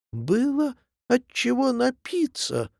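put-on high, a mild drunken yell; yelling echo. A narrator's reading. The tipsy echo retorts, a high mock yell. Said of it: "тотчас завопило эхо".

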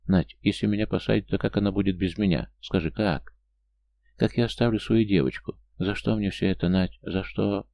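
usual speaking voice

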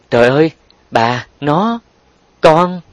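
high, broken, halting words; stammering. A narrator's reading. Man utterly taken by surprise, stammering. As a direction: falter between words, lost for speech. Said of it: "Thiên Tần lắp bắp".

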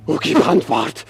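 desperate cry. Acted. Hamlet crying out to the silent ghost, pleading. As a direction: cry the title out, demanding an answer.

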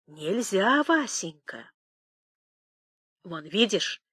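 hushed, secretive warning; quiet, stealthy confiding; furtive whisper. Acted. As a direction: keep it soft, as if afraid of being overheard. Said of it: "зашептала Пелагея, воровато оглядываясь".

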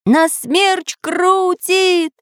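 high, very slow shout. Acted. The inventor shouting over the tornado, slow high yell. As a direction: drag the words out, yelling. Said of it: "ещё громче кричал Самоделкин".

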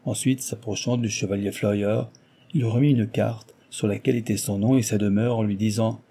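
ordinary delivery

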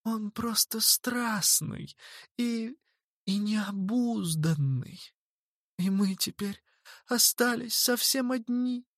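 high, soft, slow tearful plea; pleading girl voice. A narrator's reading. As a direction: plead with halting hesitation. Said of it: "подхватила Анджела".

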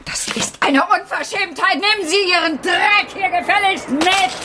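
high, indignant fury; outraged shout. Acted. Teacher furious at the departing father, high outrage. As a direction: fling it after him, outraged.

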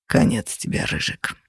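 soft, toneless near-whisper; sighed threat. A narrator's reading. Captor announces Ryzhik's end, sighing softly. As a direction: sigh it out softly, resigned. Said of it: "вздохнул Феникс".

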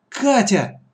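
high, loud cry of a name; startled brotherly cry; frightened exclamation. Acted. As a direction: call out to her in alarm. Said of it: "испуганно воскликнул Лёшка".

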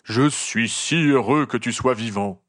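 slow, heartfelt gruff giant; gruff gamekeeper's exclamation. A narrator's reading. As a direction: exclaim slowly in a big gruff voice. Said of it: "s'écria le garde-chasse". (géant bourru et ému, débit lent)

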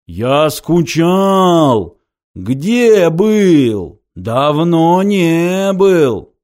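slow, loud howling creature; wolf voice. A narrator's reading. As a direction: howl the words out. Creature Ункас speaks.

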